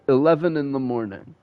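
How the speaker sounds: ordinary delivery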